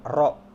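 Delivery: "ro" with a plain rolled r; emphatic "ro"; ordinary R. Shown as a demonstration. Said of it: "Ngomong R biasa".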